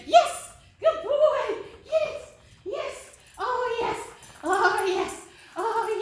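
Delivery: excited praise. Real, not acted. Praising dog in play.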